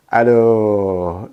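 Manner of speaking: loud, exasperated aduh; exasperated groan. Real, not acted. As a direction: groan at the absurdity. Exasperated at dictionary quality.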